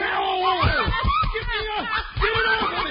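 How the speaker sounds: high, frantic shrieking in terror; panicked screaming. Acted. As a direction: yelp and beg to have it off. Trick bird jumps on him.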